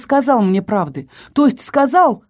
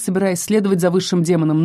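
usual speaking voice

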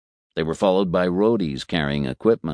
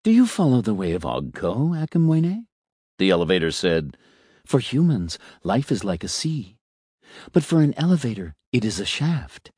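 philosophizing elevator; 2 semitones higher than usual. Acted. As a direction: savour each aphorism like a smug little philosopher.

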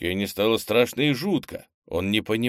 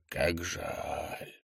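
much softer, drawn-out regret; fading whisper-soft echo; 2 semitones lower, 10 dB quieter than usual. Acted. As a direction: let the words die away. Echoed softly, fading.

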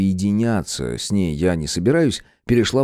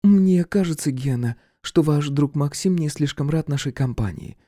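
voiced woman Viktoria; 7 semitones higher than usual. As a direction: tease about the sulky friend. Viktoria speaks.

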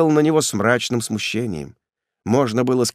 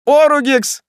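very high, loud exclamation; astonished cry; 6 dB louder than usual. A narrator's reading. Executioner hearing his own family name, astonished cry. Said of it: "вскричал палач".

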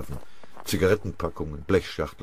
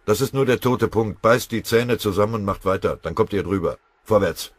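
loud, higher barking orders; harsh command; 5 semitones higher, 8 dB louder than usual. A narrator's reading. Pfennigbauer ordering the exhausted men onward, harsh and loud. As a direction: drive them forward by force of voice. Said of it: "fuhr ihn Pfennigbauer hart an".

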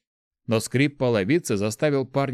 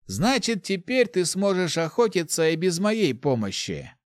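necromancer; 8 semitones higher than usual. A narrator's reading. Necromancer voiced.

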